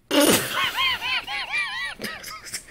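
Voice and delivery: shrieking high laughter breaking out; screaming laugh. Real, not acted. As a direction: totally lose it. Laughing fit during reading.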